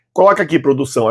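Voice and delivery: normal talking voice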